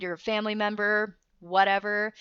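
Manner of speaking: usual speaking voice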